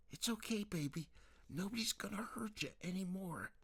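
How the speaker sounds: high, gentle mother's voice; soothing mother. Acted. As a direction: comfort her like a worried mom.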